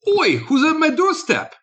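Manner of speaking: very high, comic British squawk; bad British accent. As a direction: squeal it in a badly faked British accent. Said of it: "It just doesn't work".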